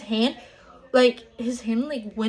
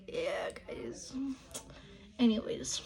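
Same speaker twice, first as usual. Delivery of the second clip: whispered aside to the viewers, much softer; 12 dB quieter than usual. Real, not acted. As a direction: drop to a breathy whisper, as if confiding.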